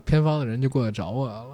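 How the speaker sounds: usual speaking voice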